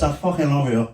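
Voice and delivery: put-on slurred Irish drunk voice; drunk Irish accent. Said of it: "That's my drunk Irish accent".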